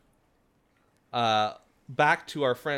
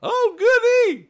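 very high, loud, slow squealing critter; excited snake voice; 13 dB louder, slower than usual. Acted. Second snake gleefully hearing there are more people. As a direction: squeal with greedy glee. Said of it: "another voice go".

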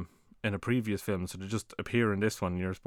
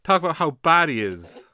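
indignant outburst; 10 semitones higher, 8 dB louder than usual. Real, not acted. Refusing to drop the topic.

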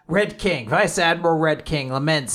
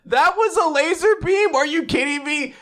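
shocked; 11 semitones higher than usual. Retold or imitated. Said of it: "Frankie's like".

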